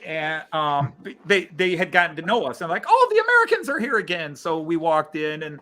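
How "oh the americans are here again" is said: high-pitched imitation of a greeting; imitating locals spotting familiar visitors, high-pitched; mock exclamation. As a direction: exclaim it in a raised, teasing voice. Acting out chemists' greeting to them.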